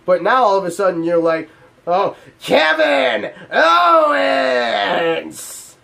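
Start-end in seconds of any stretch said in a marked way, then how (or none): 1.9-5.8 slow, drawn-out, higher mock growl; imitating a phlegmy wrestling-boss announcement; mocking Stephanie. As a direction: ham up her forced tough-girl call. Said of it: "trying to, like, get rid of some phlegm?".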